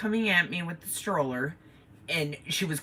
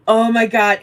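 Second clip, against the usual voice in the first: alarmed exclamation; 5 semitones higher, 9 dB louder than usual. Retold or imitated. Panicked thought at crash.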